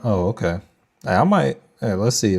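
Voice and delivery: ordinary talk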